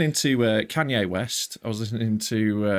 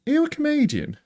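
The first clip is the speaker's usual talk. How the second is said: builder's question. Retold or imitated. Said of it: "one of them goes".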